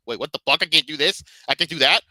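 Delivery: incredulous gamer outburst; exasperated disbelief. Acted. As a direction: play a player baffled by the limits. Acting out a frustrated player's reaction.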